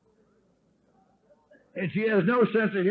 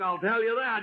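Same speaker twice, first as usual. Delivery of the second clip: ordinary talk, nothing marked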